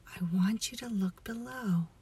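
normal talking voice

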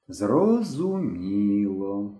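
very slow, stretched single word; drawn out. A narrator's reading. Myshko nodding at Henka's excuse, slowly drawling. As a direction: let it trail long as realization sinks in. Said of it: "протягнув Мишко".

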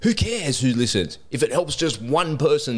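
high, insistent comeback; emphatic retort. Retold or imitated. Podcaster answering his doubting missus, high emphatic retort. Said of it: "And I'm like".

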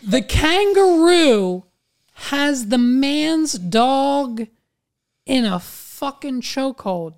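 very slow, high, drawn-out delivery; incredulous emphasis. Real, not acted. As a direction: spell out the outrage word by word. Incredulous repeat for emphasis, then quicker.

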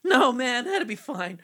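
put-on a teen stoner drawl; Shaggy voice. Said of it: "sounding like Marty McFly".

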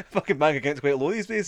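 talking through a big laugh. Real, not acted. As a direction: crack up while saying it. Cracking up over own joke.

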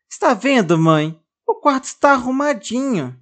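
put-on high, bright little girl showing off; a child proudly pointing out her tidy room; high-pitched eager girl; girl's voice. Girl shows tidy room to mother.

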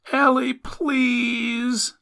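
high, drawn-out slow plea; pleading mother. A narrator's reading. Mother begs hesitant Ellie to enter, high pleading. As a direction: beg your child in a high, stretched-out voice.

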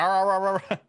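loud mock robotic growl. Imitating a broken robot's moving mouth, loud mock growl. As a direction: grunt like a broken robot jaw. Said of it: "Yeah, he's like".

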